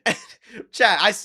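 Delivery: ordinary talk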